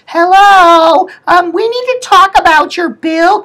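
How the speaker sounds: piercingly high sing-song imitation; mock billing lady. Lady comes in about the bill, high mocking voice. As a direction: open on a loud, high mock hello. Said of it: "some lady comes in".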